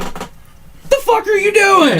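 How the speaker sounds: high and very slow, drawn-out disbelief; incredulous mock outburst. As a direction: stretch every word out in high disbelief. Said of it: "it's like".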